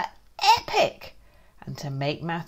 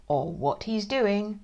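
plain reading voice

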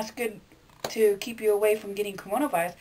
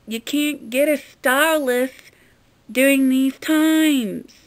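higher, louder, slow scolding put-on voice; sassy comeback; 5 semitones higher, 7 dB louder, slower than usual. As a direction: talk down to a dum-dum troll. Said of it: "tweeted back saying".

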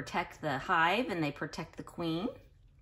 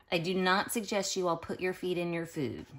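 ordinary delivery

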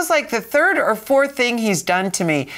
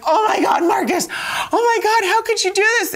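high amazed outcry; 14 semitones higher, faster than usual. Retold or imitated. Wife retelling her astonishment at Marcus's cure, high exclaiming. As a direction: squeal in disbelief at the miracle.

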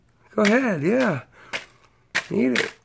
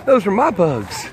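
voicing the hen; 6 semitones higher than usual. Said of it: "Half pint, like".

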